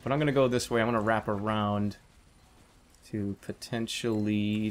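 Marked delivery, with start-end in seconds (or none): none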